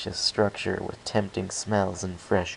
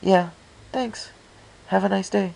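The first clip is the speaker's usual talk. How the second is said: a lightly voiced mare calling out absently, higher; 11 semitones higher, 4 dB louder than usual. Mare calling goodbye absently to the departing newcomer. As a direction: call after someone without paying attention. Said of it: "I heard her call absently".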